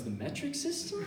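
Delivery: soft, high, questioning answer; timid guess. Retold or imitated. Friend guessing the answer for the cop, timidly. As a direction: squeak it out unsurely.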